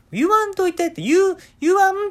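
very high, loud cry of don't say it; shrill plea. Retold or imitated. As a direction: squeal at mom to stop. Daughter shrieks stop. (高く大きな声で叫ぶ娘)

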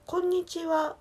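こんにちは high-pitched in standard Japanese tune; standard Japanese intonation. Shown as a demonstration. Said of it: "標準語やったら".

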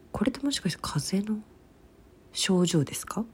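hushed worried whisper. Real, not acted. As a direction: whisper it to yourself. Worried aside about a cold.

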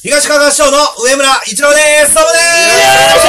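very high, loud guest entrance; shouted self-introduction. Pretend. Special guest announcing himself to listeners, shouted high. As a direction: shout your name like a grand entrance.

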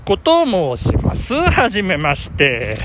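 normal talking voice

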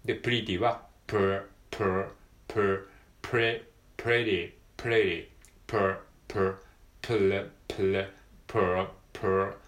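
プル said with an R as in pretty; pr drill. Shown as a demonstration. Teacher drilling R for pretty. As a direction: repeat the pr sound many times. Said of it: "これ違いますよね".

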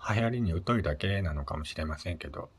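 normal talking voice

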